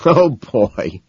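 cracking up. A narrator's reading. Van Patten hears the girls are at Cactus, cracking up. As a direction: say it on the edge of laughter. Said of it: "Van Patten cracks up".

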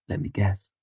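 mildly exasperated guess; a touch of weary impatience. A narrator's reading. Denner cutting off the girl's murmur, mildly exasperated. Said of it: "sounding exasperated".

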